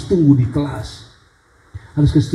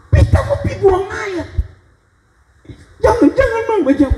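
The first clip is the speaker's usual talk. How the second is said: put-on child's plea. Child begging mother not leave.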